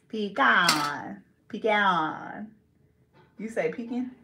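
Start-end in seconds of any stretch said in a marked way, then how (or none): 0.1-2.5 "Pecan" stretched as pee-KAAHN; drawn-out pee-KAHN. Shown as a demonstration. Showing an A can sound like O, "Pecan" as pee-KAAHN. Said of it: "a A could sound like a O". 3.7-4.1 "pecan" as PEEK-in, rising; pecan like deacon. Shown as a demonstration. Asking a friend how they say it, "pecan" rhymed with deacon. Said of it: "Like deacon".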